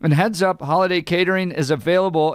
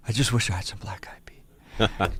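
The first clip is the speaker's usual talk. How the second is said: whispered wish, out of breath; breathless whisper; 8 semitones lower, 7 dB quieter than usual. Retold or imitated. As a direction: whisper it like a tired kid on a summit. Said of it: "I got him on video going".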